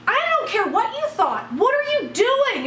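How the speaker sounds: high, indignant demand; retold outrage, pitch jumping up; indignant confrontation.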